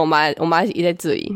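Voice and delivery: ordinary talk, nothing marked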